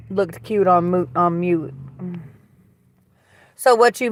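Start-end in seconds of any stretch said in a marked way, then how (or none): none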